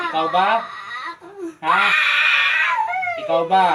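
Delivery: loud, insistent demand; forceful interrogation. Real, not acted. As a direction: fire the question out loudly. Healer presses spirit to confess. (malakas at mapilit na pagtatanong)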